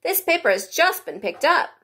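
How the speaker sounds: high rabbit voice. A narrator's reading. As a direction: pipe up like a nervous rabbit.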